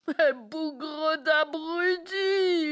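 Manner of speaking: slow, high, loud insult; drawn-out angry roar; furious yell. Acted. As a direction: bellow the insult at the servants. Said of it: "hurla-t-elle".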